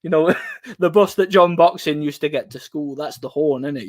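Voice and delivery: normal talking voice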